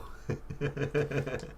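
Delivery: soft, high, rapid chuckle; a quiet giggle to yourself. Real, not acted. Chuckles about kids' slang.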